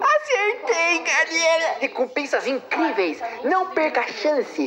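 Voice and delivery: high, hyped ad announcer voice; fake ad hype. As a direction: sell it like a cheesy ad. Parody of ad slogans.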